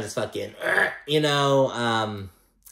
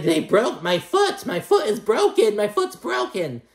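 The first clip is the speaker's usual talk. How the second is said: mock whiny wail; 9 semitones higher than usual. Adriana wailing that her foot is broken, mock whiny. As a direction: overdo the pain like a diva. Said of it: "Adriana was like".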